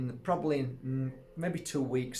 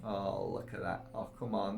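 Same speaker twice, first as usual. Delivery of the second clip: ordinary talk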